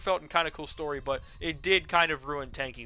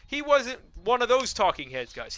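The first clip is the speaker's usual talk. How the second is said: ordinary talk, nothing marked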